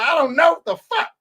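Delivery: snapped out. Retold or imitated. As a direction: act out a coach losing patience. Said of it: "He snapped out".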